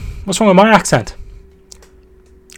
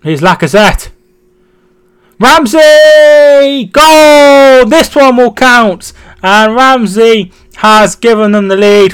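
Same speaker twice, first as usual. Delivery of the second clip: loud, high, long-held goal shout; excited goal commentary; 7 semitones higher, 7 dB louder, slower than usual. Pretend. Streamer calling Ramsey's goal for Arsenal, excited commentary. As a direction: erupt on the scorer's name, then keep it raised.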